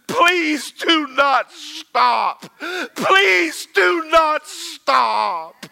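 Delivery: slow tearful plea that swells; weeping plea building to a cry. Retold or imitated. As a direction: beg through tears, rising to a shout. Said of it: "he began to weep".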